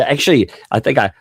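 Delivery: normal talking voice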